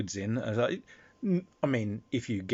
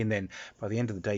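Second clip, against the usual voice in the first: ordinary talk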